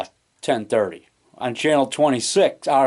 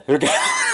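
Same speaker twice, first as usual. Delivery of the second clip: laugh. Real, not acted. Laughs after impression.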